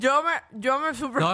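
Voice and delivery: talking around a thumb; thumb in mouth. Pretend. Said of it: "ponte el dedo gordo en la boca y di".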